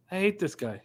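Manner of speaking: ordinary talk, nothing marked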